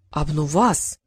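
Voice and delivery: put-on stunned old man crying out; exclaiming. A narrator's reading. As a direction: blurt the name out in shock. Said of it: "воскликнул Сутар".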